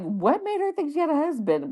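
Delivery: high, puzzled disbelief; baffled. Retold or imitated. Narrator retelling her confusion in the waiting room, high disbelief. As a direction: ask it like it makes no sense. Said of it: "I'm like".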